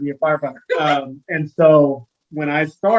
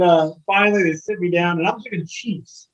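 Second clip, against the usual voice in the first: ordinary talk, nothing marked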